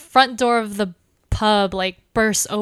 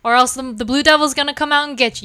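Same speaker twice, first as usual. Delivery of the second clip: loud, quick, raised voice; urgent warning; 4 semitones higher, 6 dB louder, faster than usual. Acted. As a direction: hurry everyone out with a warning. Sheriff warns pub about curfew.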